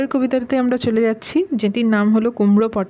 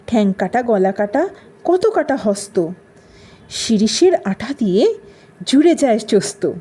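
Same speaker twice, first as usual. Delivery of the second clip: rhythmic verse, swinging intonation; dramatic poem recitation; 3 semitones higher, 5 dB louder than usual. Acted. A quack doctor boasting to patients, in theatrical rhythmic recitation. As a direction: recite in short, punchy phrases with pauses.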